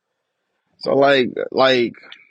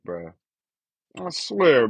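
normal talking voice

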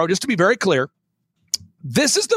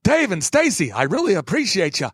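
put-on senile old man. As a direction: play a confused seventy-four-year-old. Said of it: "talk like this".

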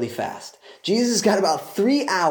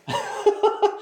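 breathy burst of laughter; bright little laugh; quick airy laugh. Real, not acted. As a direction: laugh at the thought. Laughs at own point.

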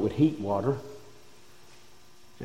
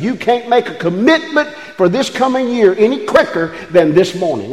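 normal talking voice

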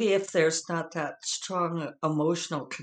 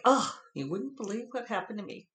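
mock dramatic sigh opener; 6 semitones higher than usual. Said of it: "be like".

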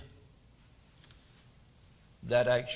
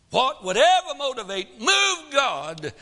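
preaching climax; 9 semitones higher, 6 dB louder than usual. Acted.

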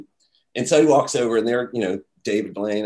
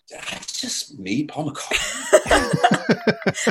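dazed disbelief; 6 semitones higher, much slower than usual. Retold or imitated. Stunned after meeting idol.

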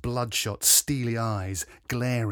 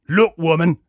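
loud, raised-pitch snarl; 4 semitones higher, 10 dB louder than usual. A narrator's reading. Stocky patient to receptionist, snarl. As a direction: snarl it to cut her off. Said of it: "he snarled".

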